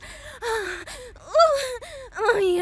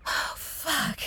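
whisper; 9 dB quieter than usual. Acted. Spent afterglow.

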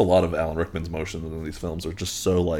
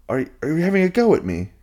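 put-on accusing kid; 10 semitones higher, 4 dB louder than usual. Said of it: "turns to Harry and goes".

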